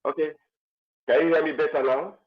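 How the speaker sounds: low mic, voice hard to hear; barely audible. Real, not acted. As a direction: still faint and far off. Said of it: "Your voice is very low".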